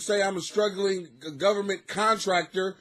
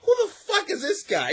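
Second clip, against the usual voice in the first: higher, strained incredulous question; mock baffled boss; 12 semitones higher than usual. Bosses wonder who hired the comic, in hammed-up disbelief. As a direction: imitate the bosses finding him out, aghast.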